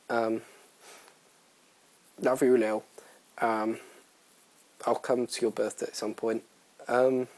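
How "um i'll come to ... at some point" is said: usual speaking voice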